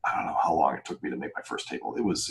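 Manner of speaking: ordinary talk, nothing marked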